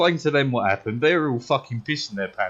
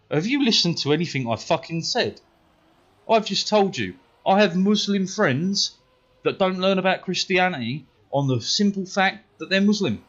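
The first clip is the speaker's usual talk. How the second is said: higher, indignant self-defence; exasperated retort; 5 semitones higher than usual. Retold or imitated. Pupil to teacher who called him a liar, exasperated. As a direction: argue back, exasperated at not being heard.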